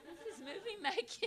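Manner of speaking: high, squeezed, laughing mock cry; mock tearful awe. Director among weeping actresses, mock-tearful. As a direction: pretend to cry through a laugh. Director retelling mass crying scene.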